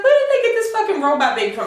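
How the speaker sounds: incredulous. Retold or imitated. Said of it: "I'm just like".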